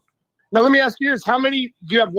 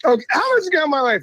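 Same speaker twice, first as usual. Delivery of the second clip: high, fast retold objection; exasperated protest; 9 semitones higher, faster than usual. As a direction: rattle off a pleading challenge. Retold argument with the teacher.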